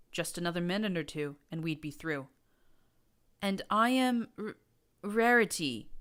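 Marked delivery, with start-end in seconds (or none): 3.4-5.9 higher, louder, faltering with fear; shaky voice. A narrator's reading. Terrified mare naming herself to the armed prince, shaky. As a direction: stammer the name out in terror. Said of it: "Roses said in a shaky voice".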